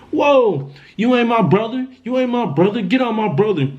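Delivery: high-pitched retold protest; acting out a heated standoff, high but not shouted; retold alarmed protest. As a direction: push back in alarm, pitch jumping up. Retold confrontation with possessed brother.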